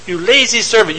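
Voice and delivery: a touch of a scolding master; mock indignant rebuke. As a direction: scold the lazy servant in the master's voice.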